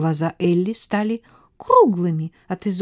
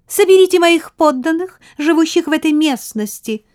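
high, louder royal order; commanding mouse queen; 10 semitones higher, 6 dB louder than usual. A narrator's reading. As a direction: give the order as a tiny queen. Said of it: "приказала королева".

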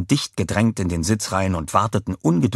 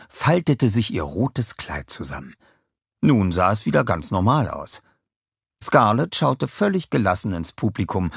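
ordinary delivery

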